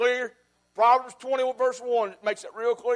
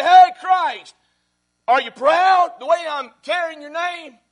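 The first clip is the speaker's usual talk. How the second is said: much higher, louder, eager address, slowing on the question; imagined call; 7 semitones higher, 10 dB louder, slower than usual. Acted. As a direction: call out to Christ and ask if he is proud.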